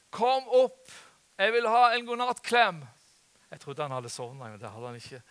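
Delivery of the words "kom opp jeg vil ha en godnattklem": imitated child's high, loud demand; high-pitched mock kid's shout; calling out. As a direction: call downstairs like a grandchild at bedtime. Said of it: "hørte jeg en som ropte".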